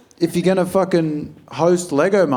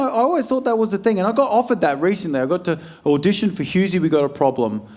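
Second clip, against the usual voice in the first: ordinary delivery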